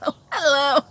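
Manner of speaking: giggling through a hello; embarrassed laughing hello. Real, not acted. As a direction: laugh the greeting out. Laughing through awkward greeting.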